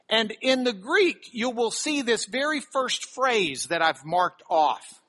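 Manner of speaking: ordinary delivery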